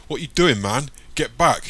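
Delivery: loud, high warning yell; threatening shout. A narrator's reading. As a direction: shout him back at gunpoint. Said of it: "shouts the man".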